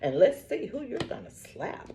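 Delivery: ordinary talk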